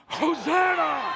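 high, slow, drawn-out shout; full-lunged shout. Shown as a demonstration. Preacher leading the standing congregation as a welcoming crowd, shouting. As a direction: fill your lungs and cheer it out loud. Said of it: "breath in your lungs".

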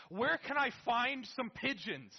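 loud, high, slow frantic question; frantic search. Retold or imitated. Steve scrambling online for new pigeons, frantic. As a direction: panic as you ask it.

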